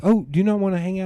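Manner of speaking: high, quick imitation of mom; mom's surprised question. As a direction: lift it high and fast, playfully put out. Mom pleading with departing child.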